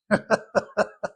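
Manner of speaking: chuckling at own remark; high, breathy run of chuckles; amused laugh. Real, not acted. Preacher amused at own joke to congregation, laughing.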